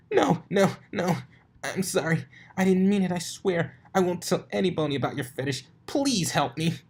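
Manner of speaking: high, loud frantic pleading; begging. Acted. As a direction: beg for your life. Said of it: "begging for forgiveness".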